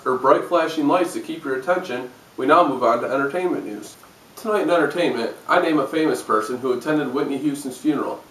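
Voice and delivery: usual speaking voice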